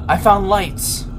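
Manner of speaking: relieved delight; thrilled finding; excited. Real, not acted.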